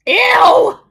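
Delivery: a piercing high ew of real disgust; disgusted shriek. Real, not acted. Grossed out by the liquid from his mouth, a shrieking ew. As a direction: recoil with a loud shrieking ew.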